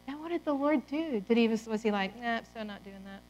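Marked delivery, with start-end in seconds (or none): 2.2-3.2 mock dismissive.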